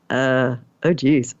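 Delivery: low, hesitant sinking reaction; retold uh-oh moment, pitch dropped; uneasy dread. Reacting to an alarming text.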